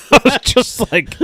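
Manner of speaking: high-pitched laughing through the words. Real, not acted. Patient laughing recalling the doctor's blunt news, high laughter. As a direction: laugh high while telling it.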